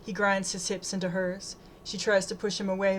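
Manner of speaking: ordinary delivery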